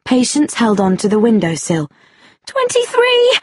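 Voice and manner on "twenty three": very high, urgent call. A narrator's reading. Woman on a ledge to man below, calling out. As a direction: call the answer down in panic. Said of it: "she called".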